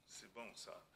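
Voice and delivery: usual speaking voice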